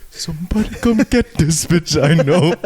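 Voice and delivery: a lighter echo of the friend's hollering; friend's cry retold again, half-laughing and lighter; lighter repeat of mock yell. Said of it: "I hear".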